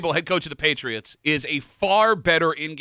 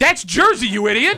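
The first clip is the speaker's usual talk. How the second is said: loud teasing; 8 semitones higher, 7 dB louder than usual. Real, not acted. Teasing cohost over accent.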